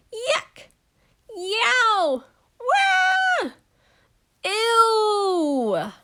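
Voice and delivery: high, loud cartoon yelps of pain. Burglars hit by traps, yelping loud and high. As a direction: yelp as each trap hits.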